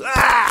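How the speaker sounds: short high yelp of disgust; a quick pained "ah" at the thought of sardines; sharp mock cry of distaste. Yelp after choosing sardines.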